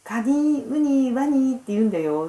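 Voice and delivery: a hint of a child's eager explaining; lightly voiced grandson. (孫の口調を軽く真似て)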